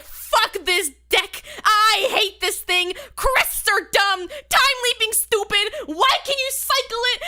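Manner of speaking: hammed-up fuming rant; retold angry rant. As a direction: hammer each word out, climbing in exasperation. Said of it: "I was angry. I was like".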